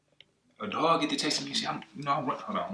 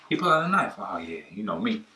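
usual speaking voice

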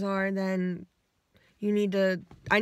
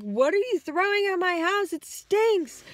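mock yell; 9 semitones higher, 3 dB louder than usual. Yelling at people throwing stuff.

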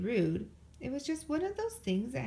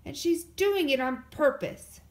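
slow, loud, higher whine; complaining; 5 semitones higher, 7 dB louder than usual. A narrator's reading. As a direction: accuse her to Papa. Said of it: "complained Brother".

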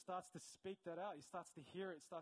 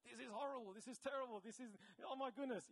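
higher-pitched, put-on alarm; mock dismay; 6 semitones higher than usual. Imitating a client seeing the same situation, dismayed. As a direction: lament it in mock horror. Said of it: "someone else that's looking at the same situation's like".

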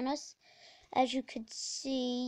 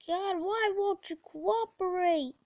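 louder, higher, frustrated plea; exasperated complaint; 6 semitones higher, 5 dB louder than usual. Real, not acted. Gamer pleading with the controls, loud exasperation. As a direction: wail it in exasperation.

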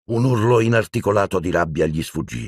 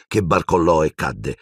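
ordinary delivery, nothing marked

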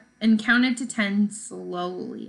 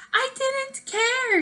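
much higher, slow cry of protest; cried out; slower than usual. Acted. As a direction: wail it out, hands over ears. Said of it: "she cried".

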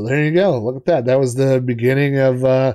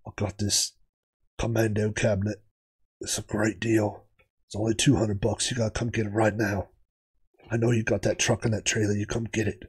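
Dean impersonation; 2 semitones lower, 7 dB quieter than usual. Retold or imitated. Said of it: "I do a good Dean impersonation".

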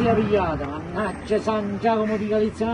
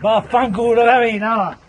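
angry shout; 2 semitones higher, 8 dB louder than usual. Real, not acted. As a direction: blow up and yell the curse. Frustrated man cursing at task.

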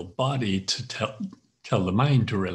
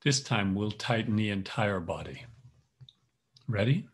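calm instructor guiding each tense and release; guided yoga nidra. Acted. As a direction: lead the body scan, firm on tighten, easing on let go. Leads tense-relax yoga nidra.

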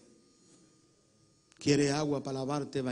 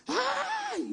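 imitated scream. Preacher imitating people crying out during the reading, high scream. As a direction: imitate a congregant's high wail. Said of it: "la gente empezó a gritar".

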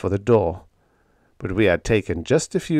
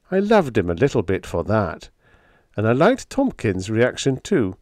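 ordinary delivery, nothing marked

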